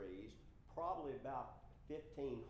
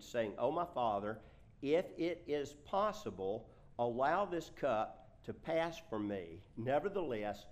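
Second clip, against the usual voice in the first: loud, slow, deliberate Bible reading; measured reading aloud to a congregation; scripture reading; 7 dB louder than usual. Acted. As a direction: project each phrase with weight.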